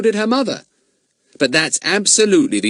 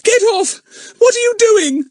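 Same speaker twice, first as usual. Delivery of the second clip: very high, loud breathless cry; 6 dB louder than usual. A narrator's reading. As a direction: cry out squashed flat. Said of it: "cried Eunice".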